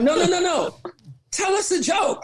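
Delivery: mock shouted demand from a pushy friend; insistent demand. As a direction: refuse to take no for an answer. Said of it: "And Rachel's like".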